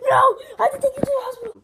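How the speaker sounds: high, loud, fast cry of alarm; panicked outburst. Acted. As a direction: rush it out in a panic. Panics over hurt Luigi.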